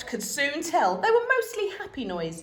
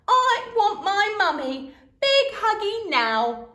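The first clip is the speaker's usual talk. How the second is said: put-on high, slow baby wail; whiny toddler; 10 semitones higher, 6 dB louder, slower than usual. A narrator's reading. Toddler wanting mummy, slow whiny wail. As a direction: whine like a clingy toddler.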